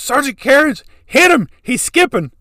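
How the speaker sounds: panicked shout to the sergeant; yelling over the truck noise; frantic yell. Retold or imitated. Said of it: "He goes".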